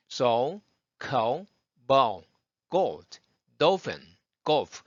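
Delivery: words said one by one with dark "우"-like L; breath-held word drill. Shown as a demonstration. Teacher drills words without breathing, dark L like "우". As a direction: punch each word without taking a breath. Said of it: "숨을 쉬지 말고 하시라는".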